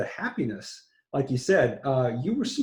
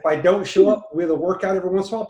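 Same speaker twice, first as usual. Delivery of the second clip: excited rush; 6 semitones higher, 5 dB louder, much faster than usual. Real, not acted. Excited speech, fast and loud.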